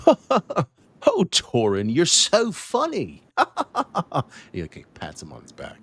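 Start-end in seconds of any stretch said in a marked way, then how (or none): 0.1-3.2 high, slow, sugary flattery; fawning gentleman. Acted. Gentleman flattering Torin with a laugh. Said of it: "and he's saying". 3.3-4.5 high put-on chuckle; fawning laugh. Gentleman laughing at Torin's joke. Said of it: "and he's saying".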